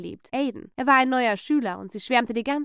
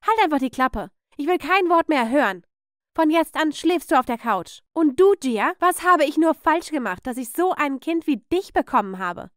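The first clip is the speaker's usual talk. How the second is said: loud, raised telling-off; irate aunt berating a child; angry scolding; 5 semitones higher, 6 dB louder than usual. Acted. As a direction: lay down the law, loud and high.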